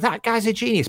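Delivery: high, loud mock gasp of awe; amazed audience. Audience member gushing over a comic's quick response, high and awed. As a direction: gush like a wowed crowd. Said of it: "everyone's like".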